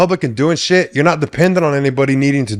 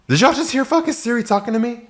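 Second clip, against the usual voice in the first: usual speaking voice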